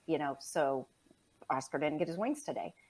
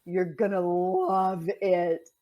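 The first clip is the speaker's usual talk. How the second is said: teasing; 6 dB louder, much slower than usual. Real, not acted. Teasing about kitty litter cake.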